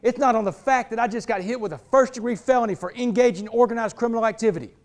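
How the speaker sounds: ordinary delivery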